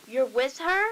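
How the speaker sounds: loud, incredulous retold question; imitated shock. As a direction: act out the friend's stunned question. Retelling friend's shocked question.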